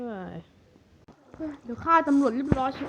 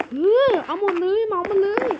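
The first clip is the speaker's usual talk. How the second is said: higher, louder, grab-it-all cry; greedy excitement; 6 semitones higher, 4 dB louder than usual. Acted. Robber urges his partner to take everything, excited. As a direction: shout to clean the place out.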